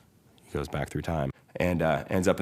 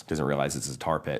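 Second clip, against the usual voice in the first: ordinary delivery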